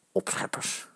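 very soft, unvoiced grumble; grumbled. A narrator's reading. Kees grumbling about the rival fans, soft grumble. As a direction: grumble the insult under your breath. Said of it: "bromt Kees".

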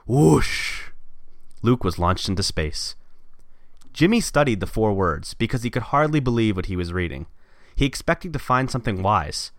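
reading a story aloud; steady storyteller read; story narration. Acted. Reads own story aloud.

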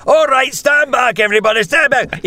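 high, urgent crowd-control character; bystander taking charge. Acted. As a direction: call it out high, clearing everyone away. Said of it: "and all the characters".

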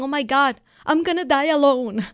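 a touch of hammed-up dread; mock panic. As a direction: ham up a lonely client's panic. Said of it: "they're thinking".

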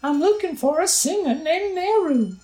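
very high, slow falsetto for a grateful nurse; Impa voice. Acted. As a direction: read it like a gentle, breathy-high old nurse in a game. Voices Impa's game dialogue.

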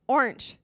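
Orange with a stretched, odd vowel; funny orange. Shown as a demonstration. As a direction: say Orange in her own odd way. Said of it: "one more time".

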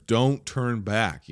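ordinary talk

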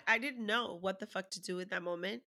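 normal talking voice

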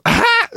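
sudden loud, very high "ah!" breaking into laughs; high yelp into laughter. Retold or imitated. Zach looks up at the joke, startled high laughing yelp. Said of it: "just looks up and just goes".

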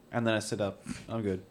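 ordinary talk

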